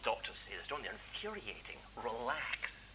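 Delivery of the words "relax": soothing doctor. Acted.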